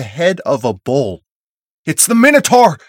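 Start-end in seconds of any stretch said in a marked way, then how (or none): 1.8-2.8 high, loud cry of alarm; shouted alarm. A narrator's reading. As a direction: shout out what is coming. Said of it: "Connor shouts".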